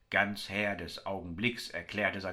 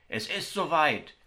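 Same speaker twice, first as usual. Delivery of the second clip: higher and louder, the idea bursts out; excited outburst of a brooding man; bursting out; 7 semitones higher, 5 dB louder than usual. A narrator's reading. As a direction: blurt it like a sudden revelation. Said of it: "entfuhr es dem Brüter".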